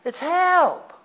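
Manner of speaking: high, loud desperate plea; a desperate call for help; pleading cry for help. Shown as a demonstration. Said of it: "You know how I pray about one like that?".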